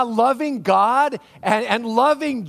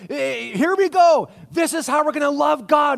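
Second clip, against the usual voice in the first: eager anticipation; 7 semitones higher, 5 dB louder than usual. Retold or imitated. Congregation member waiting for the big answer, mock eager. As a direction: hype it up like a crowd awaiting the punchline. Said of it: "It feels to me like".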